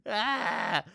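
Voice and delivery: loud, high comic sob; mock wail. Husband crying to his wife over a show, mock wail. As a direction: burst into a fake bawl. Imitates own wailing after sad show.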